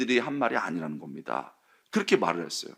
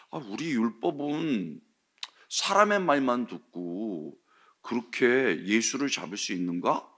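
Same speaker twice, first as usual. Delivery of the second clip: slow, softer measured objection; calm quiet reasoning; 3 semitones lower, 6 dB quieter, slower than usual. Retold or imitated. Nicodemus calmly objecting to the council, slow and soft. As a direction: lay out the point gently and precisely. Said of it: "조곤조곤 아주 정밀하게 얘기하는".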